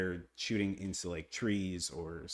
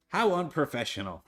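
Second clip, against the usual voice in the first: laughing; 5 dB louder than usual. Real, not acted. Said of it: "Sorry, I have gum in my mouth".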